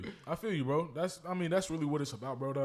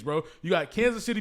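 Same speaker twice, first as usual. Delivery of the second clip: ordinary talk, nothing marked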